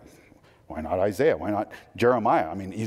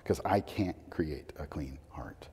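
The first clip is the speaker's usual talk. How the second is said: hushed, breathy confession; whisper; 6 semitones lower, 10 dB quieter than usual. Real, not acted. Preacher to congregation, confessing in a whisper. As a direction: whisper it as a humble admission. Confessing own inability after psalm.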